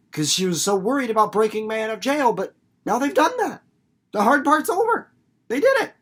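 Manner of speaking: climbing high and loud with triumph; rising to a triumphant squeal; giddy relief. Retold or imitated. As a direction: Winnie cheering that the jailbreak worked. Acting out Winnie's relief.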